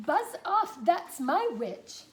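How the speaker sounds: high, loud monster yelling; terrible beast voice. Acted. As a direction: bellow it like a scary swamp beast. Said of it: "its terrible voice ... a yowl and a growl and a croak and a shriek".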